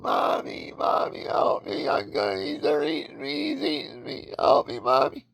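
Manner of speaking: high, panicked cry for mommy; child screaming for mommy as the crocodile takes him; retold child screaming. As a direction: shriek like a terrified child being dragged off. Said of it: "screaming, child going".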